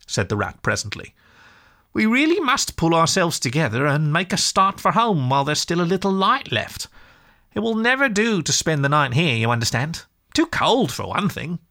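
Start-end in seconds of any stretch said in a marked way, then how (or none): 1.9-11.6 high-pitched storybook animal, urging; rally a tired friend in a high voice; brisk, friendly Rat. Acted. Rat urges Mole to start for home, high and firm.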